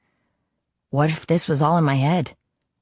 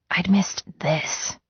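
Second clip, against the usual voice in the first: hushed whisper; 4 semitones higher, slower than usual. A narrator's reading. Emotional narration drops to whisper.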